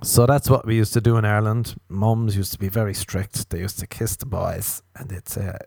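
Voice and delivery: ordinary talk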